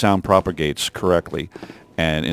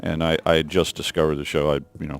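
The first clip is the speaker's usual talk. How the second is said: ordinary talk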